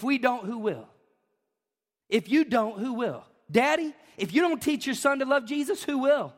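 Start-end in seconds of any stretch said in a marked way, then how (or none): none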